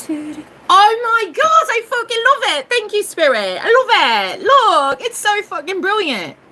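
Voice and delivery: high, squealing excitement; real elation. Real, not acted. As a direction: cry out in delighted thanks, stretching the words. Thrilled at the card she drew.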